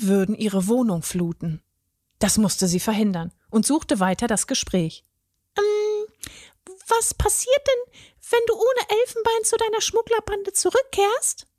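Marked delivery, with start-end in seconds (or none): 5.6-11.5 very high fairy voice, careful; a fairy gently changing the subject; cautious fairy. A narrator's reading. The fairy asks the tearful troll about his gang, high and careful.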